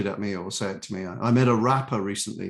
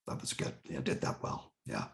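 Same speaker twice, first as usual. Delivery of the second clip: low, very soft muttered commentary; muttering; 11 semitones lower, 13 dB quieter than usual. Retold or imitated. Eccentric man commenting to a fellow performer mid-performance, muttering. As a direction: mutter it almost without voice, under your breath. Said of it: "He was just muttering to me".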